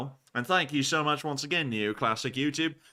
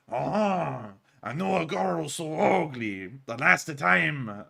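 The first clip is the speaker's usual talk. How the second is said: lightly hammed Italian accent, slow; mock Italian accent; much slower than usual. Streamer trying an Italian accent on a joke. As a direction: drag the joke out as a cartoon Italian. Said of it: "now I'm turning Italian".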